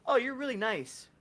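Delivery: loud, high parody of a compliment; exaggerated, mocking niceness; mock sweetness. Mocking the character's too-brief reply.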